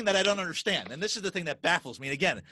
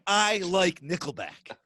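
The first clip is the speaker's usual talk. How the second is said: very slow, deliberate declaration; proud declaration; much slower than usual. Acted. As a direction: spell it out slowly, as if standing up before everyone. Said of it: "stand up in society and say".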